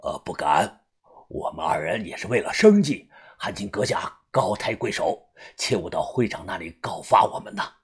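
bow and plead in a small voice; cowering old man. Acted. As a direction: fat old fighter humbly begging for mercy. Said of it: "胖的那个人立即弯腰说道".

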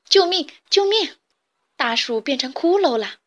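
high, loud panicked cries; terrified screaming. Acted. Chicks terrified of bare trees, high screams. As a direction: scream for help in fright. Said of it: "小鸡们叫着".

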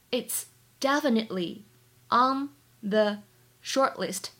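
slow dictation with a pause after each word or two. Shown as a demonstration. English teacher reads the dialogue for learners to follow, word by word. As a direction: break the sentence into separate, careful pieces.

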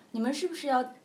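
put-on a child asks if he'll die; lightly voiced scared boy; frightened prince.

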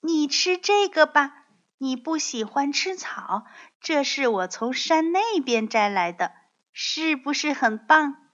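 put-on high, eager child voice; proud baby dinosaur. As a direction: show off your gift to daddy.

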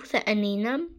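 ordinary delivery

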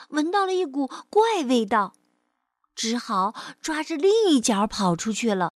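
measured, expressive storyteller voice; children's story narration. Acted. Teacher reads a picture-book story to children, storytelling. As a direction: tell it to a small child.

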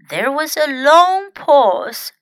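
usual speaking voice

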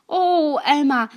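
high, loud desperate call; crying out. Acted. Webb begs Elmer not to leave him in the tree, high loud cry. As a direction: call after them as they leave. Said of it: "韦伯叫道".

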